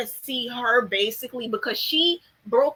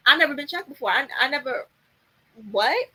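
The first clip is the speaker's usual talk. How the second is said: flustered disbelief; 3 semitones higher, faster than usual. Retold or imitated. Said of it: "She was flabbergasted".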